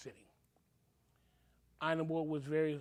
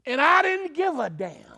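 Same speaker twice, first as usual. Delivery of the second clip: defiant outburst; 10 semitones higher, 12 dB louder than usual. Real, not acted. Defiant about attacking officials.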